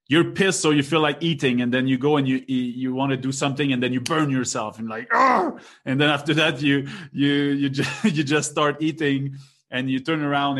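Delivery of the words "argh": pained yell. Retold or imitated. Burning himself while cooking.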